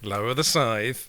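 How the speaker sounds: Death voice. Acted.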